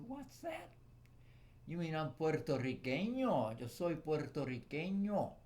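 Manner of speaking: high, loud put-on voice; mock confused American. Mocking an American who can't say puertorriqueño, high and loud. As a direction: ham up the baffled outsider.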